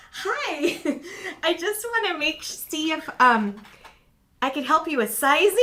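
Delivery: high, sugary mock shop-clerk voice; mock saleslady. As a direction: parody the overly sweet saleslady with a laugh. Mocking the saleslady's sweet voice.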